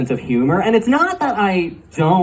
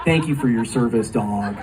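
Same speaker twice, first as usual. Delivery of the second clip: impressed bro; slower than usual. Retold or imitated. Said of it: "any bro ... is gonna be like".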